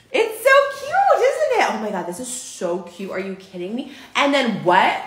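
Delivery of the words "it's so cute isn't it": very high, slow, gushing coo. Real, not acted. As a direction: swoon over how cute it is. Gushing over the sleep mask.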